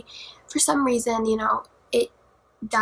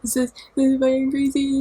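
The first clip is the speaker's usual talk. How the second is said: retold freak-out; 5 dB louder than usual. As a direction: imitate a panicked plea for rescue, voice rising. Retold frantic text to dad.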